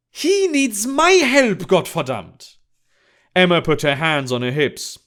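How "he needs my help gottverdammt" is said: high-pitched, drawn-out irritated retort; exasperated outburst. Acted. Emma corrects Thaddeus on who needs help, exasperated. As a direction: burst out indignantly, pitch leaping up then dropping on the curse.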